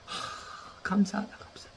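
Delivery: unvoiced whisper of thanks; relieved whisper. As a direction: breathe it out in relief.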